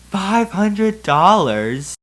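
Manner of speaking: normal talking voice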